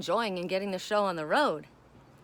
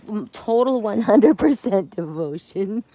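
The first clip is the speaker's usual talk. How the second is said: slow, louder, drawn-out demand for devotion; cat's voice; 10 dB louder than usual. Acted. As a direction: speak as the pampered cat, slow and loud.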